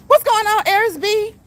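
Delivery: high, loud, delighted shout-out; excited greeting. Real, not acted. Streamer greeting viewers as they join the chat, excited.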